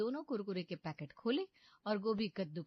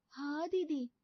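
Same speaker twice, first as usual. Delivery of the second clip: ordinary delivery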